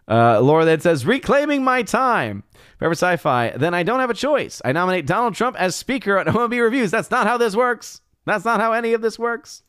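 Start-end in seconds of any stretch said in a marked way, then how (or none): 1.1-6.9 high mock politician. Reading Laura's chat mock voice. 6.9-9.6 high mocking meme quote, much higher pitch.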